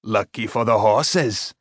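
lightly voiced old smith, slow; a slow, dry put-down in character. A narrator's reading. Gruff smith answers the boy dryly.

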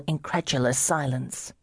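ordinary delivery

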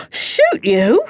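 startled housekeeper, pitch jumps up; voice rising high. A narrator's reading. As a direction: shoot up in pitch with alarm. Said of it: "His housekeeper's voice rose an octave".

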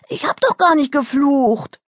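put-on high-pitched child's wail; whining. Daughter punished with soap for swearing, whining. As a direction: plead innocence like a wronged child. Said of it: "jammerte sie".

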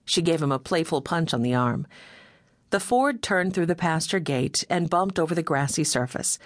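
usual speaking voice

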